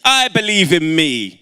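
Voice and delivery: loud, declarative call; call-out affirmation. Real, not acted. Speaker leading audience in affirmations, loud proclaiming call. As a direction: proclaim it for the crowd to echo. Leads crowd in call and response.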